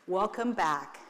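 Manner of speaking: ordinary delivery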